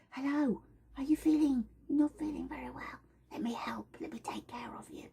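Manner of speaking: a gentle oil spirit whispering softly; whispery Monarda voice. Acted. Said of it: "And then she goes".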